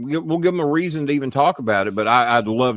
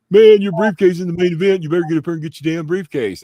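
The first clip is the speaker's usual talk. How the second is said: loud, high retold call to climb up; shouting up the tower in a retold scene; retold yell; 7 semitones higher, 6 dB louder than usual. Retold yelling from the press.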